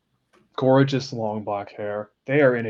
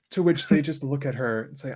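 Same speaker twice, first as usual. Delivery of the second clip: ordinary delivery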